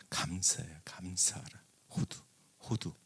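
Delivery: breathy whisper, no voice at all; hushed whisper to the congregation. Acted. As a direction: lean in and whisper it like a secret. Whispering the Hebrew word.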